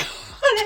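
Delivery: a high laugh breaking out; high burst of laughter. Real, not acted. Laughing at the couple's crying.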